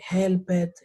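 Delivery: "helped" in two syllables, "hel-ped"; pronounced as spelt. Shown as a demonstration. Teacher shows learners' expected "helped", read as two syllables. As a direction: sound out the E in "helped". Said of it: "se pronuncia tal y como se ve".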